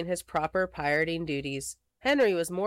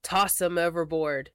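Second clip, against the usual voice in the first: usual speaking voice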